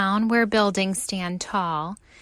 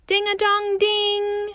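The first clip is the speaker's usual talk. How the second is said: high sung doorbell; doorbell chime; 9 semitones higher than usual. A narrator's reading. Narrator chiming the doorbell.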